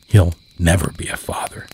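usual speaking voice

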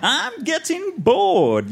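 high, drawn-out villain yell; Nagash. A narrator's reading. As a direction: yell it slowly like an impatient overlord. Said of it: "yells Nagash".

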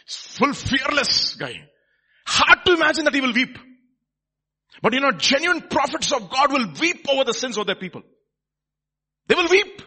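loud, high-pitched, driving proclamation; full-force preaching. Real, not acted. Preacher tells people genuine prophets will weep, full-force preaching. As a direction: preach it hard, stretching key words.

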